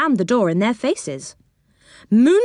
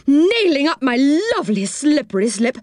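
high-pitched, outraged exclamation; indignant outburst; 7 semitones higher than usual. A narrator's reading. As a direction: fume in a raised pitch. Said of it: "his big round face getting redder and redder".

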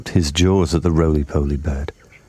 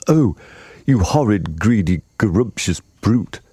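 raised, drawn-out indignant outburst; indignant cry; 3 semitones higher, slower than usual. A narrator's reading. Humpy Rumpy scolding the greedy crocodile, indignant. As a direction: cry out in mild outrage. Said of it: "cried Humpy Rumpy".

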